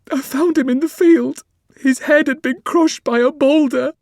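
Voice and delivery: high, loud, tearful telling; crying woman. Acted. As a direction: build to near-sobbing, high and loud. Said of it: "The woman started crying again".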